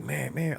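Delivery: high-pitched honking noise; imitated car horn. Host imitating a road-rager behind his office manager, imitated car horn. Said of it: "honking the, honking the shit out of her".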